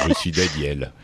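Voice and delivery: a hint of Daniel's flat self-introduction; imitating Daniel. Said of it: "Daniel, c'est vraiment".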